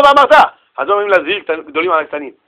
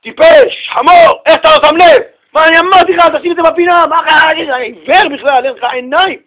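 high, loud scolding tirade; angry father shouting; 8 semitones higher, 4 dB louder than usual. Acted. Father yells at child over a broken glass, furious. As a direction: yell at the child in acted outrage. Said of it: "האבא הזה צועק על כוס".

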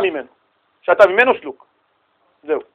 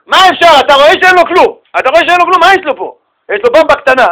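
indignant outburst; 7 semitones higher, 5 dB louder, faster than usual. Real, not acted. Indignant at someone asking to share.

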